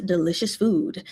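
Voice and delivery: plain reading voice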